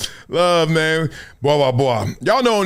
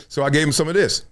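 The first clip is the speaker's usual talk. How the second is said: ordinary talk, nothing marked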